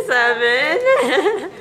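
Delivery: giggling through the word; laughing. Real, not acted. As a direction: laugh while counting. Laughing during the challenge.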